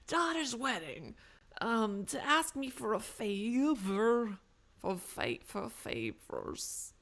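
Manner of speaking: slow mob boss drawl; hammed-up mafioso, very slow delivery; American mafioso. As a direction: stretch it out like a mafia don. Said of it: "like, an American mafioso".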